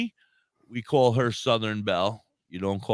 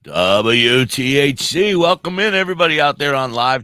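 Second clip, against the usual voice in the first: announcer intro; 9 dB louder than usual. Acted. Opening the live show.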